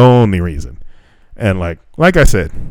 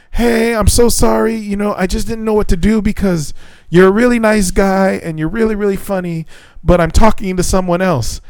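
higher sweet mimicry of her apology; mock sweet girl voice; 10 semitones higher than usual. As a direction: mimic her letting him down gently.

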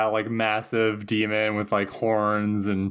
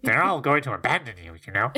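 quick little demon voice with a laugh behind it; Gaknar; faster than usual. Acted. Tiny demon taunts Buffy about her friends, hammed-up jeer. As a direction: needle them as a tiny demon. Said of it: "then Gaknar says".

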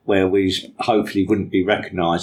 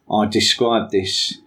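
ordinary talk

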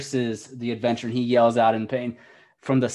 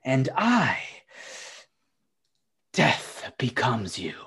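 witch voice; slower than usual. Acted.

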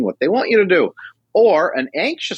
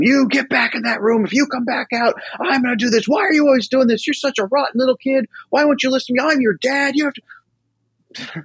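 much higher, strained mock yell; mock screaming dad; 12 semitones higher than usual. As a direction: play the insecure dad scolding his son. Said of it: "screaming at him".

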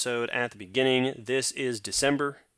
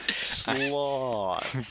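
drawn-out lazy sloth voice; super slow sleepy sloth; 2 semitones higher than usual. Pretend. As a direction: stretch the word out slowly like a sloth. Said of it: "You have to say it like a sloth".

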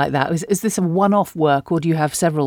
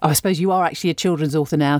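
normal talking voice

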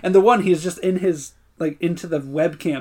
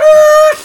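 loud sudden yelp; startled yelp; 15 dB louder than usual. Retold or imitated. Retelling the car braking, a sudden loud yelp. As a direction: shriek as the brakes hit. Brakes slammed in retold scene.

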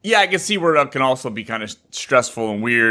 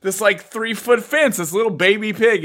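higher, slowing on three-foot fence; mock amazement; 6 semitones higher than usual. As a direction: marvel at the tiny pig's jump. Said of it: "And I was like".

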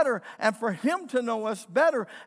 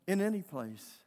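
ordinary talk

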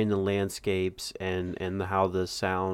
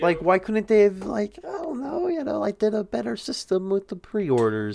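exasperated high voice; 13 semitones higher, 4 dB louder than usual. Real, not acted. Exasperated rant about pre-orders.

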